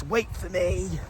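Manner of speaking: high, soft, squeaky little cry; high mock call. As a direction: call out in a tiny high-pitched voice. Sprinting down beach, mock call.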